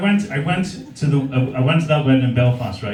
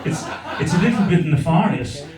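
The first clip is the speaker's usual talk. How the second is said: mock posh English accent. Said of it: "as I'm standing there going".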